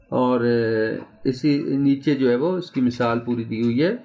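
normal talking voice

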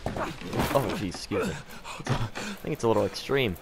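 ordinary delivery

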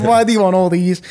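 hammed-up mock Scottish; terrible Scottish accent. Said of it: "that was a terrible Scottish accent".

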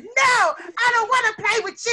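loud, high yell of rejection; yelled refusal. Acted. Child yelling at a classmate who asked to play, loud rejection. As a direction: yell a mean no at a playmate. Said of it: "If somebody yelled at you".

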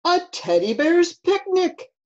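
loud, high-rising delighted bellow; big, excited bear announcement. Acted. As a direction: bellow it out like a hungry storybook bear. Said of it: "he bellowed".

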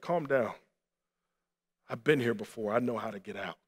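low, soft, steadying voice; calm reassurance. Retold or imitated. Soldier who jumped in to the trapped man, calm and reassuring. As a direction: settle him down, quietly sure. Rescuer calms the panicking man.

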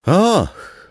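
high loud delighted oh; excited exclamation. Acted. Tall soldier thrilled by a mystery, loud high exclamation. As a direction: gasp with glee at a secret. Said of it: "wykrzyknął wysoki żołnierz".

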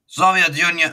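loud, high mock Iranian accent shout; attempted Sheik accent. A wrestling vlogger imitating the Iron Sheik, loud mock Iranian accent. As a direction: bellow it in a bad Sheik accent. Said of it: "I can't do his accent".

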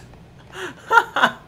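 real high, loud burst of laughter; high cackling laugh. Real, not acted. Gamer cracks up at the trick working, high loud laugh.

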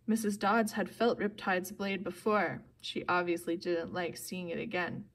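ordinary delivery, nothing marked

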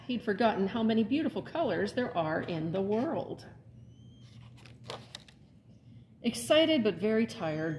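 lively, expressive storybook read-aloud; animated picture-book narration; reading to children. A narrator's reading. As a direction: read it like story time for little ones. Librarian reads picture book aloud.

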